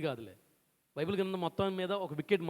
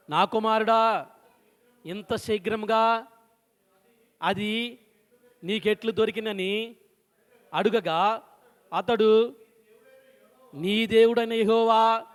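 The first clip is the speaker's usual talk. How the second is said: solemn scripture reading; 2 semitones higher, 7 dB louder, much slower than usual. Acted. Reads scripture verse aloud.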